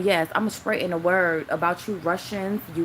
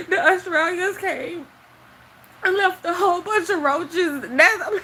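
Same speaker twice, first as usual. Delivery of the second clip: high, wheezing laugh through the words; can barely get it out for laughing; laughing so hard it almost sounds like crying; crying with laughter; 11 semitones higher, 4 dB louder, slower than usual. Real, not acted. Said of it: "It was just so funny".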